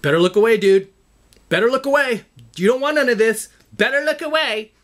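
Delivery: high, loud macho challenge; mock threat to a staring stranger; tough guy glare. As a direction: stare him down and dare him. Acting out a mean-mugging dude.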